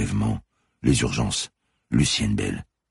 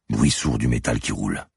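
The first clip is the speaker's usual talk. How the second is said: plain reading voice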